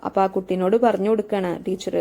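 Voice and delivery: normal talking voice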